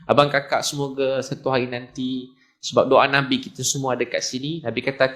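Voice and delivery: usual speaking voice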